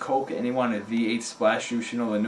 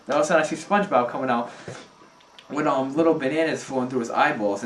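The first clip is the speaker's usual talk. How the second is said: ordinary delivery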